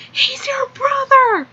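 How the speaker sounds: high, slow, urgent whisper-shout; stage-whispered reveal. Retold or imitated. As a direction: blurt the secret in a loud hiss.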